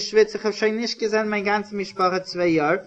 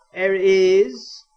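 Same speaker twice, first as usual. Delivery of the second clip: ordinary delivery, nothing marked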